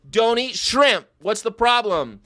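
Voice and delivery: loud mock-angry order; exasperated shout. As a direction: bark it as a scolding. Exasperated outburst about eating shrimp.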